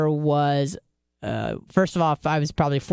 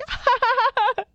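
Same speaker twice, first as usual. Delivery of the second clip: high half-crying laugh. Retold or imitated.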